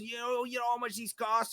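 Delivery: mock the lawyer's whiny accusation; neurotic whiny voice. Lawyer whining at the maintenance worker over his towels. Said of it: "The fucking neurotic, whiny, like, voice".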